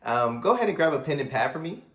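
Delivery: cheerful high-energy sales voice; matching high energy. Shown as a demonstration. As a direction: bounce back at their excitement. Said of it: "So you see that subtle difference?".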